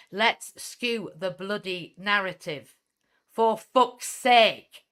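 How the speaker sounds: slow, emphatic build to a loud cry; exasperated outburst. Real, not acted. Speaker rallies listeners to change the narrative, exasperated outburst.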